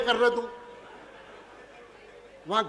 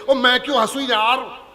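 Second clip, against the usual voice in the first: louder, higher, puffed-up rich man; snooty rich man; 4 semitones higher, 6 dB louder than usual. Retold or imitated. Rich guest in the audience refusing to laugh, pompous and loud. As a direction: boom it out like a self-important moneybag. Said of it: "भाई साहब, आप हंस क्यों नहीं रहे?".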